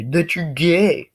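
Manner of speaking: high, drawn-out jeer; mock taunting bully. Bully insists on his insult, mock taunting. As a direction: jeer back high and slow.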